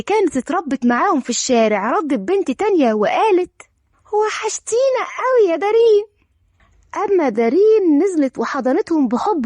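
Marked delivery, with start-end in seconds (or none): 4.1-6.0 put-on high-pitched small girl's voice; childlike, raised-pitch affection; little girl. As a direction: greet a missed friend as a child would. Another girl greets Darin.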